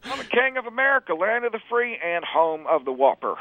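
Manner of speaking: hammed-up presidential boast, laughing; mock Bush voice. Bush boasts about America, high mock voice.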